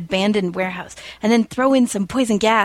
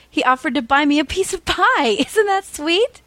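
high, laughing gush; giggling Penny; 8 semitones higher than usual. A narrator's reading. Penny telling Billy about the pie, high and giggly. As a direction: gush and giggle, much higher.

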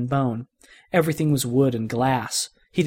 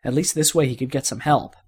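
ordinary delivery, nothing marked

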